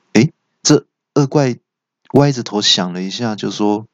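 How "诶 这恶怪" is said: usual speaking voice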